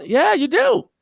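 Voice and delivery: high-pitched, insistent comeback; emphatic retort. Acted. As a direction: cut off the complaint, voice jumping up high. Answering an imagined complaint back.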